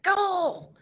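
high, excited send-off; playful mom pushing the kid out the door; mock cheering mother. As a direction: shoo them off with a cheer. Mother sending grown child off.

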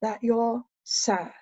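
hushed and unhurried, long pauses between phrases; slow, soft therapist voice. Shown as a demonstration. Therapist telling her client she seems sad, slow and soft. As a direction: go gently, letting each phrase sink in. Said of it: "So I slow down".

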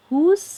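high, loud rising "Whose", begun with H; pronunciation demo. Shown as a demonstration. Teacher reading out WHOSE for students, emphatic H-sound demo. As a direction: model "Whose" loudly with an H, no W. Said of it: "อันนี้เสียงเหอะเหมือนกันนะ".

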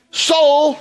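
high, loud call of Soul; David calling out to his soul; commanding call. Retold or imitated. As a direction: summon your soul sharply. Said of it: "It's like he's saying".

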